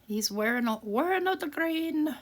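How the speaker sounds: a high, hammed-up stage Irish accent; fake Irish accent. Crafter joking to viewers about the leprechaun's green, in a high mock Irish accent. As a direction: play a bad Irish brogue for a laugh. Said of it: "a terrible fake Irish accent".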